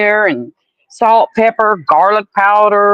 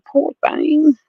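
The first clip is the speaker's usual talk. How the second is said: pitying coo; 9 semitones higher than usual. Retold or imitated. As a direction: coo it at the itchy dog. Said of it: "I'm like".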